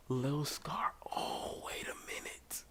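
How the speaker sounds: whispered to himself; soft whisper while thinking. Real, not acted. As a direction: hush it like a secret thought.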